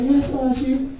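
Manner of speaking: high, soft timid answer; meek wife. Retold or imitated. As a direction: answer timidly, dreading what's next. Wife voiced meekly.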